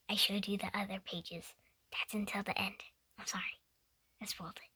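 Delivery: soft, hushed apology; embarrassed whispered sorry to the listeners; sheepish whisper. Real, not acted. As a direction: whisper it like you got caught. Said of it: "reader admits the spoiler".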